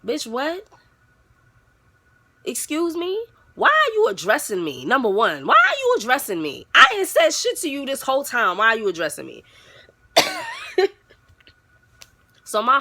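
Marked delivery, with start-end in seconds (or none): 0.1-9.4 higher, sharp disbelief; indignant. Retold or imitated. Snapping back at the girl who called her out. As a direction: bristle at being called out. Indignant retort in retold argument.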